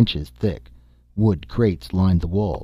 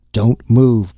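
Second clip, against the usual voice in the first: louder, higher warning cry; sudden raised-voice warning; urgent cry; 5 semitones higher, 8 dB louder than usual. A narrator's reading. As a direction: cry out to freeze them on the spot. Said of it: "Dorley cried".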